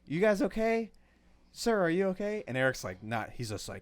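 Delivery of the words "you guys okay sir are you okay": higher, louder worried lady voice; concerned lady. Retold or imitated. Teller imitates the older woman asking if they're okay, higher and worried. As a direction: ask like a startled older woman checking on him. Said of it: "the lady's like".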